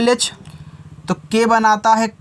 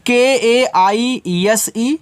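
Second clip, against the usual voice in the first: letter by letter; much slower than usual. Shown as a demonstration. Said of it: "देखो के से का".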